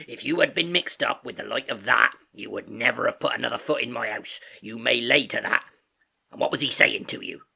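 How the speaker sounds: stern sailor's warning; Long John Silver. Acted. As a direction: lay down the law as the landlord. Silver scolds and questions Morgan.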